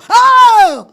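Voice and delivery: very loud, high jolted-awake cry; startled yell. Retold or imitated. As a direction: yell as if shocked out of sleep. Acting being jolted awake from sleep.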